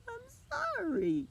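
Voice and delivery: very high, drawn-out wailed apology; hammed-up kid bawling an apology; mock crying. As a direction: squeal it slowly like a crying child. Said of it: "I start crying".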